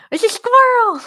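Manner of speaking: very high, loud lisping squeal; Sid the Sloth lisp. Acted. Demonstrating how she and her friend would talk, excited high lisp. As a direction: shriek it with a slushy cartoon lisp. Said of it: "The lisp".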